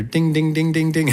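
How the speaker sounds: high, even, repeated dings; ding like a crossing bell, high and steady; imitated crossing bell. Storyteller imitates the train warning bell, high ringing monotone.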